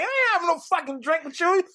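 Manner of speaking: high, loud hammed-up fury; furious mock yell at a creep; mock angry shout. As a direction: threaten him in front of everyone, half laughing. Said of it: "Real loud".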